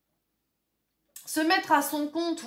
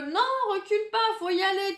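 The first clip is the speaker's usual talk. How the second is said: higher-pitched mock protest; urging on; 8 semitones higher than usual. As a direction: egg on a hesitant friend, voice raised in pitch. Joue celui qui pousse le Fou.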